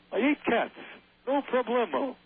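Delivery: high-pitched wisecracking alien puppet; cocky high creature impression; Alf. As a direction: do the sitcom alien, voice pushed up. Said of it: "Willie from Alf".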